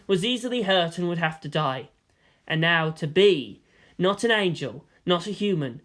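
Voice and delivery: plain reading voice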